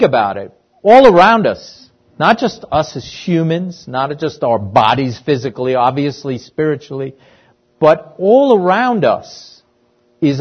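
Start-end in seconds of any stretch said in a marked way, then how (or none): 0.8-1.9 all punched higher and louder; stress on all. Acted. Preacher stresses a point. 7.8-9.6 all pushed higher, slowed down; preacher slowing down to hammer all; slow stress on all. Acted. Preacher restates it slowly.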